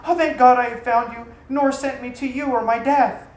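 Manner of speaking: loud, breathless cry of relief; relieved cry. A narrator's reading. Slave girl reaching her lost master, crying out with relief. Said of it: "cried out with relief".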